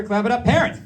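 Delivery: loud, rising hype shout; rallying the crowd. Real, not acted. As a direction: call out high to get applause. Hyping the crowd to applaud.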